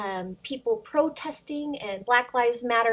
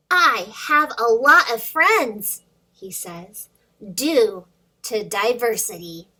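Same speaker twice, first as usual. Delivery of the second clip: put-on lively read-aloud for little kids; storybook reading; 5 dB louder than usual. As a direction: read the rhyme to children, bouncing along. Reads picture book to kids.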